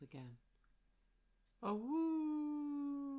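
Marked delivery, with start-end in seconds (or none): 1.6-3.2 long high wolf howl, full voice. Acted. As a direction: throw your head back and howl. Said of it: "looks up at the moon and howls".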